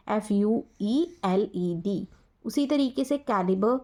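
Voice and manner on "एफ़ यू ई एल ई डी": slow spelling out. Shown as a demonstration. Teacher dictates the British spelling slowly to students. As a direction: spell F U E double L E D slowly, letter by letter. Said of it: "American spellings of fueled".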